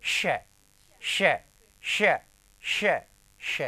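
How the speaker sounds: श repeated alone as a strong hushing sound; short separate bursts of hushed श; isolated sound drill. Shown as a demonstration. Teacher showing where it is spoken, repeated श drill. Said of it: "तो हम क्या बोलेंगे?".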